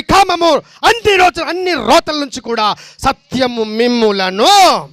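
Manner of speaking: high, loud, ringing declaration of truth; fervent preaching. Acted. Preacher to the congregation, fervent loud preaching. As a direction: proclaim it to the whole church.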